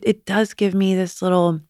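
ordinary talk

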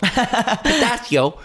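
high, very slow "pistachio" through laughter; drawn-out gush. Pretend. Raving about her gelato flavor, slow high gush with laughter. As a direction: stretch "pistachio" out lovingly.

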